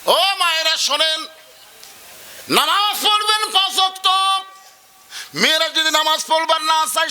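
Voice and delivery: preacher exhorting the women; forceful preaching. Real, not acted. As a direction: call out to the mothers.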